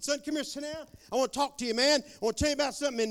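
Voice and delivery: high, loud, eager father's voice; a father bursting to share news; eager dad calling son. Retold or imitated. Dad calls his son over, high and eager.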